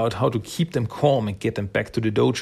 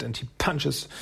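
usual speaking voice

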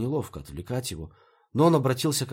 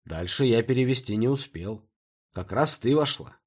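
usual speaking voice

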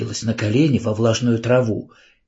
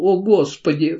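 sobbing; 7 semitones higher than usual. A narrator's reading. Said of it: "всхлипнула она".

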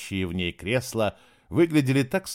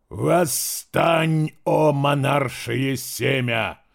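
booming divine command, higher and louder; thundering otherworldly voice; 4 semitones higher, 4 dB louder, much slower than usual. Acted. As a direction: proclaim it like a god from beyond. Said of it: "громыхнул потусторонний голос".

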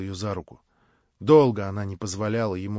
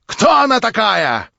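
громкий высокий требовательный вопрос; потребуй ответа сверху вниз; гневный вопрос; 11 semitones higher, 10 dB louder than usual. Acted. Варвара требует ответа. (грозный допрос)

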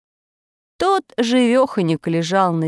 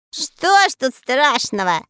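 high, cocky squeak; boastful little voice; 6 semitones higher, 3 dB louder than usual. A narrator's reading. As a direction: show off to the elves. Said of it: "хвастливый голосок Мурзилки". (хвастливый голосок Мурзилки)

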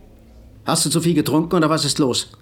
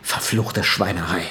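muttered curse; 6 semitones lower, slower than usual. Acted. Silbermann alone, cursing his situation.

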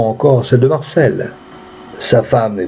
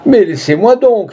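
higher, sharp cry of anguish; a pained outburst, pushing her away; 10 semitones higher than usual. A narrator's reading. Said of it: "cria-t-il".

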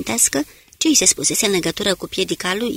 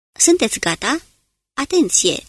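urgent call; 5 semitones higher than usual. Acted. Smith readies men for attack.

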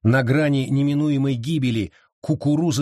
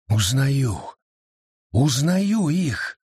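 wild delight; much slower than usual. A narrator's reading. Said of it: "с диким восторгом в голосе вскричал зверь".

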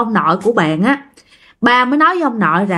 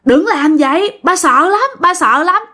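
frightened pleading cry; 6 semitones higher than usual. Retold or imitated. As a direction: plead for mercy in a high, repeating wail. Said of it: "cái ổng la".

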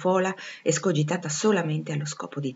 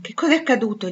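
higher, urgent question; anxious questioning; 6 semitones higher, 5 dB louder, faster than usual. A narrator's reading. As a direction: ask with mild alarm. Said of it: "gli domandò". (domanda un po' allarmata)

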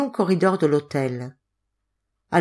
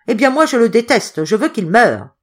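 loud, raised-pitch outburst; furious hatred; 5 semitones higher, 6 dB louder than usual. A narrator's reading. Hotelier demanding the cat's death, furious. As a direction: declare your loathing with full force.

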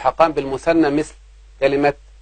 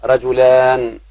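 slow quoted verse; 4 dB louder, much slower than usual. Shown as a demonstration. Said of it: "وقال تعالى".

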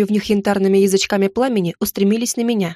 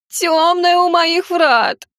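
slow, very high, whiny whimper; whimpering gargoyle; 8 semitones higher, 3 dB louder, much slower than usual. Acted. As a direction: whine it out pitifully. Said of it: "захныкала статуя".

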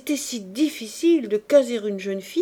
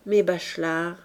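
ordinary delivery, nothing marked

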